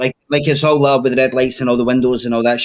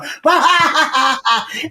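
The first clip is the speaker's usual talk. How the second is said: high, far-off cackle of laughter; howling laugh; 10 semitones higher than usual. Retold or imitated. Laughing at the fallen woman, howling laugh. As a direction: let a big howl of laughter out. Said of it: "proper howling".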